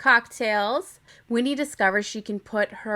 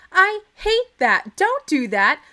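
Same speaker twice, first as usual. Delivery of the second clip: indignant outburst; 8 semitones higher, 5 dB louder, slower than usual. Real, not acted. Reacting to pre-selected rooms.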